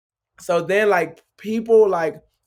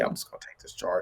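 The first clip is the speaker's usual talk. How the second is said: whispered inner thought; 6 semitones lower, 10 dB quieter than usual. Retold or imitated. Inner thought before taking charge.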